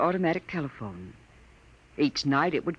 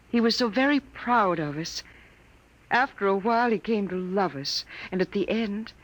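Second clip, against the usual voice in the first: ordinary talk, nothing marked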